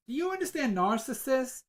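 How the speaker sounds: high, loud, acted-out blurt; blurted question. Medium finally saying it to the grieving woman, loud blurt.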